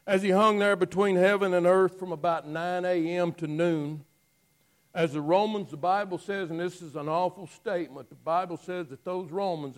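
loud, fervent preacher's voice rising over the congregation; preaching. Acted. Preacher to congregation, crucifixion scene, loud fervent preaching. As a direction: preach it out with fire. Preaching sermon at full force.

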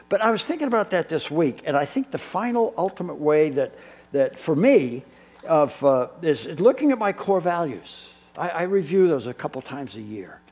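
normal talking voice

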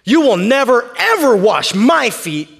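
NEVER and EVER punched loud and drawn out; loud refusal, leaning on "never, ever"; emphatic Peter. Acted. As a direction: protest slowly, hitting "never" and "ever" hard. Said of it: "this is about as emphatic".

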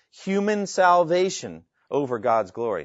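big emphasis on "human salvation", "over God's glory" as an afterthought; change of intonation. Shown as a demonstration. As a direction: lift "human salvation", then let "over God's glory" fall away. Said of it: "Note the change of intonation".

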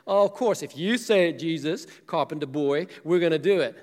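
loud mocking sarcasm; sarcastic. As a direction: throw it back at the carpenter. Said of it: "ornery and sarcastic".

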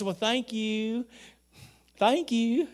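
high-pitched, drawn-out Ozarks drawl; Ozarks accent. Acted. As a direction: say it with an Ozarks twang, rising at the end. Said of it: "he wasn't from the Ozarks".

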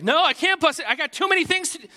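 put-on high, loud, frazzled teen snapping at the boss; panicked outburst. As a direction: blurt it out in a flustered panic. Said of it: "in my panic".

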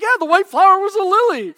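thrilled exclaiming realization; excited. Retold or imitated. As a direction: blurt out the amazing connection.

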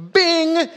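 high, loud playful bing; cartoon impact noise, loud; hip-check sound effect. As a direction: shout the sound of the bump. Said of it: "and I go".

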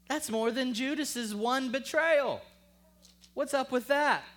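loud, high, challenging questions; forceful preaching. Real, not acted. Preacher questioning Peter's different treatment, forceful and challenging. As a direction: press the injustice on the congregation.

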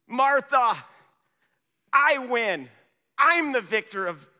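high, loud victory cry; ringing, triumphant preacher shout; triumphant declaration. Retold or imitated. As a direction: proclaim it like a victor. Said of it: "he, he, he triumphantly declares".

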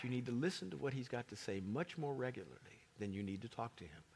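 ordinary talk

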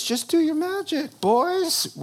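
high, sneering king voice; mocking king. King taunting his psychics, high and mocking. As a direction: tease them like a bored tyrant.

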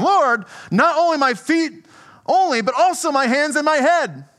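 eager Peter. Acted. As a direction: blurt it out like an overeager disciple. Eager Peter voiced in reading.

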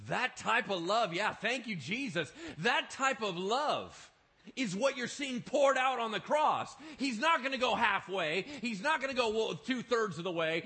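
fervent preaching. Acted. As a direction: proclaim it to the congregation. Preaching at full force.

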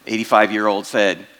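normal talking voice

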